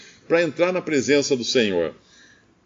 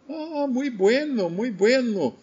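high, slow Portuguese Spanish mix; Avelino Portuguese Spanish mix; 9 semitones higher, slower than usual. Retold or imitated. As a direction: serene old man in Portuguese Spanish mix. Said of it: "E ele falou assim".

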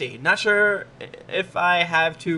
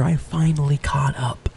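breathy mock-ASMR whisper; 2 semitones lower than usual. Host reading a fan review as a joke ASMR whisper. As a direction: hush it like an ASMR video.

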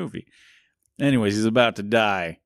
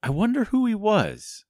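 high, lightly voiced Mickey; a hint of a squeaky cartoon mouse; high mock-innocent mouse voice; 11 semitones higher than usual. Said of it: "And he's like".

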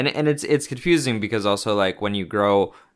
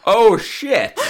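laughing out oh shit; 12 semitones higher, 5 dB louder than usual. Real, not acted. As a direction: burst out laughing, high. Laughs at episode topic.

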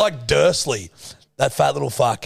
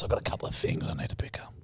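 whisper; 8 semitones lower, 13 dB quieter, much faster than usual. Retold or imitated. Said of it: "She goes".

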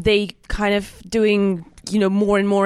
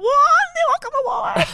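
high, thrilled shout of joy; excited cry; slower than usual. Real, not acted. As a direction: burst out with delight. Announcing their surprise award win.

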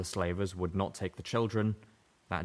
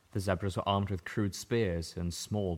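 usual speaking voice

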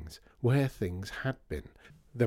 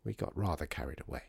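soft, low admission; sad; 5 semitones lower, 6 dB quieter, faster than usual. A narrator's reading. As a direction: confess it quietly, with regret. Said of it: "said Pansy sadly".

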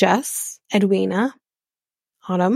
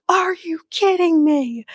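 retold shocked scream; 9 semitones higher than usual. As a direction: shriek it in outraged disbelief. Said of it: "I audibly screamed".

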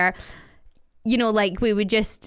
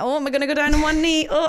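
mock joking partner; 6 semitones higher than usual. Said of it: "he's been joking".

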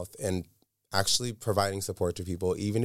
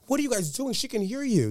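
much higher, incredulous protest; shocked high-pitched challenge; retold outrage. Telling the gossiping partygoers they can be heard, in a high shocked voice.

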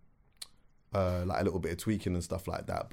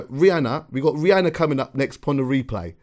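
higher, louder mock radio link; hammed-up daytime radio voice; imitated daytime presenter; 5 semitones higher, 7 dB louder than usual. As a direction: lift it like an upbeat daytime DJ. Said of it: "talk a little bit like this".

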